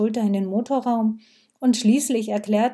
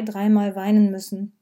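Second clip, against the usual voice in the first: ordinary delivery, nothing marked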